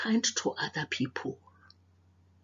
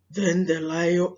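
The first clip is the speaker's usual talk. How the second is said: plain reading voice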